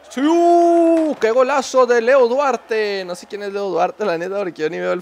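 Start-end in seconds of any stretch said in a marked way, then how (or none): 0.1-3.0 loud, high, slow goal call; goal celebration. Real, not acted. (narración de gol emocionada)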